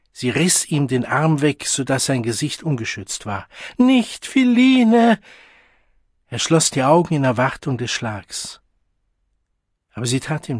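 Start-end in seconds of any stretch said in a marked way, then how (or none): 3.8-5.2 very high, loud desperate scream; terrified cry. A narrator's reading. Child with unprotected face before the blow, screaming in fear.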